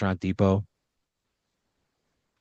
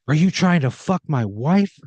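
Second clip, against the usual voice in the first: higher and louder jealous yell; furious accusation; 6 semitones higher, 5 dB louder than usual. Acted. Danny accusing Pat over the poem, furious shout. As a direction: yell it at the man by your wife. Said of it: "And he goes".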